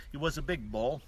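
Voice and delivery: ordinary talk, nothing marked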